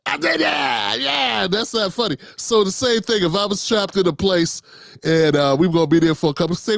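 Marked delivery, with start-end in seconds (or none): 0.0-1.5 much higher, hammed-up yelling; mock yell. Imitating Donnell yelling instead of jokes. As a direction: shout it like a triumphant comic. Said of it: "so he just yells at you".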